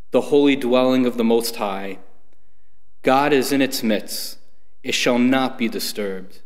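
ordinary talk

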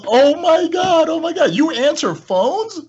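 very high, slow oh my God; astonished squeal. Retold or imitated. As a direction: squeal in disbelief.

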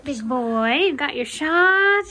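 high cooing pet talk. Real, not acted. Talking sweetly to the cat.

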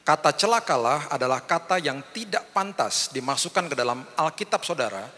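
ordinary delivery

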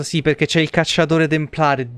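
usual speaking voice

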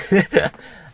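a loud, high laugh that breaks out; burst of laughter. Real, not acted. Fan cracking up at her own Kylo line, loud high laugh. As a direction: laugh at your own joke.

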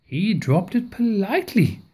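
usual speaking voice

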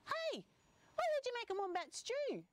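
high, quick lizard voice; high-pitched nosy little animal; interrupting lizard. Acted. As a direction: butt in with a squeaky, hurried character voice. Lizard butts in on dingo.

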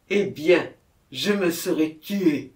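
loud, desperate answer; anguished outburst. A narrator's reading. Murderer answering the commissioner, anguished outburst.

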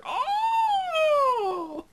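very high long pretend wail; a long, very high mock scream of despair; mock anguished wail. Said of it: "You'd just hear".

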